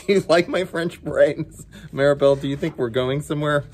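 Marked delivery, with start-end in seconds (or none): none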